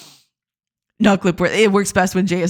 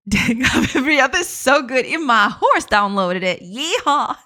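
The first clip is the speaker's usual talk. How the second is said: playful Southern US twang; Southern American accent; 4 semitones higher than usual. Acted. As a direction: ham it up as a Southern horse owner. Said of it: "I can do the accent for a little bit".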